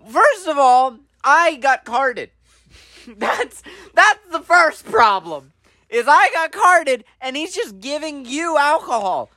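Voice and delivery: high, slow, drawn-out indignation; comic aggrieved protest; indignant complaint. Retold or imitated. Retold complaint about being carded.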